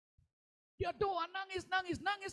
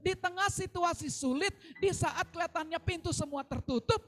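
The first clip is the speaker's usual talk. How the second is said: fiery preaching; 7 semitones higher, 8 dB louder than usual. Real, not acted. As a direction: lift the church with joy, full voice. Preaching climax.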